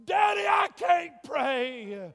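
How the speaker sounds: loud, high desperate cries; retold screaming. Dying girl's last words to her father, loud screaming. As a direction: plead that you can't pray. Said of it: "heard his daughter screaming from that car".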